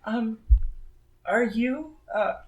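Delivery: put-on halting, shy child's question; timid little girl. Little girl asking if you are a Fate, timid. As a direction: ask it hesitantly, with small pauses. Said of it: "walks up to you kinda timidly".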